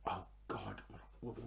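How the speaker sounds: hushed frantic whisper; panicked whisper. Retold or imitated. Panicked whisper, kid near.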